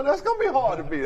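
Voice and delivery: chuckling, stressing the pitch to the student; laughing emphasis. Shown as a demonstration. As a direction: say it again with a laugh to drive it home. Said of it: "I, I wanna say that".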